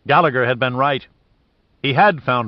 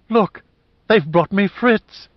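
much higher, louder, slowing on the last words; excited cry; 9 semitones higher, 4 dB louder, slower than usual. Acted. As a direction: cry out in joyful recognition. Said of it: "she cried in sudden comprehension".

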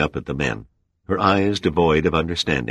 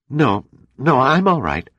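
higher, louder woman's voice, protesting; insistent woman; 10 semitones higher, 4 dB louder than usual. Acted. Dana reassuring the Admiral after spilling the glass, protesting. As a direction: push past the pain to insist.

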